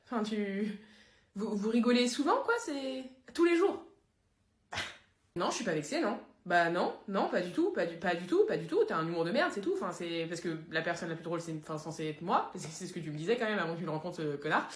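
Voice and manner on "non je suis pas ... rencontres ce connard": low, soft, bitter sulking; sulky denial. Acted. Jealous friend sulking about his jokes, bitter mutter. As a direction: grumble it out, wounded pride.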